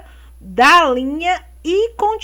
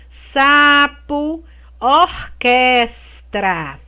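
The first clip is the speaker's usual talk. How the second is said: slow syllable reading; 4 dB louder, much slower than usual. Shown as a demonstration. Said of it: "vocês vão separar a sílaba aqui".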